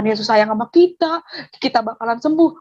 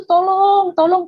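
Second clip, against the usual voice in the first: imitated call for help; 6 semitones higher, slower than usual. Woman in mud calls for help.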